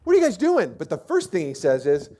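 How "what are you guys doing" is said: high, loud incredulous challenge; David challenging soldiers. Retold or imitated. David shouting to soldiers along the battle line, incredulous. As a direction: call out in disbelief at the frozen soldiers. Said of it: "David's going up and down the battle line going".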